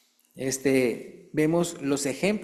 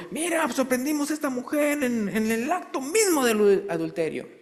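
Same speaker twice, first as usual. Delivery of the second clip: accusing crowd; 9 semitones higher than usual. Retold or imitated. Accusers bring the woman.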